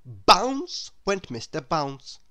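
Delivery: usual speaking voice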